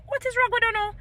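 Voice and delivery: high, exasperated what is wrong with you now; exasperated retort. Retold or imitated. Scolding Noah Lyles, high and exasperated. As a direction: throw up your hands at him. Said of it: "I said, Noah Lyles".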